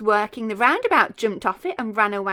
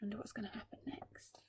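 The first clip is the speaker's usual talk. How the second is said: breathy voiceless, fast whisper; whispered aside; 22 dB quieter, faster than usual. Real, not acted. Reader teasing the children, fast whisper.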